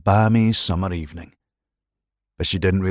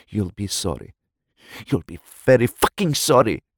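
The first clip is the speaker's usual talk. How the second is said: woman threatens her lover in rising fury; hissed rage; 4 semitones higher than usual. A narrator's reading. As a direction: threaten him through held-back tears. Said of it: "she'd hissed".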